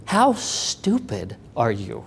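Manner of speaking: slow, low, restrained incredulous anger; pissed-off boss. Retold or imitated. Boss upset at an employee quitting, retold anger. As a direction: draw it out slowly, quietly appalled. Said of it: "and he was pissed".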